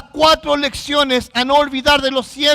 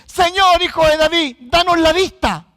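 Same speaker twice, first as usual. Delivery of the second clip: raised, higher pleading call; imitated crying out; 4 semitones higher, 4 dB louder than usual. Blind men asking Christ for sight, calling out. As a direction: call out like a beggar to the Lord. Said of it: "ellos dicen".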